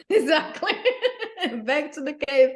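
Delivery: real laughter bursting through the words; laughing. Real, not acted. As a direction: laugh out the answer, pitch jumping up. Laughs at interviewer's quip.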